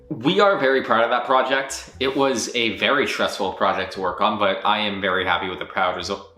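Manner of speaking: usual speaking voice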